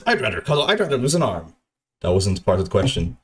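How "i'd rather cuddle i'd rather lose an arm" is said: imitated refusal. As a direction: lift the voice to mimic his dodge. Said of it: "He said".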